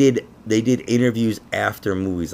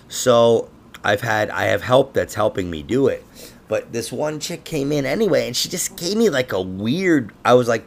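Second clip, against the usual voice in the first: ordinary delivery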